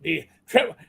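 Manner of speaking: ordinary delivery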